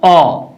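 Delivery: ओ said as an open "aw", between o and aa; open "aw" vowel. Shown as a demonstration. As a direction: round ओ into the vowel of "ball". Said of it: "न तो ओ है". (ओ को "ऑ" की तरह बोलें)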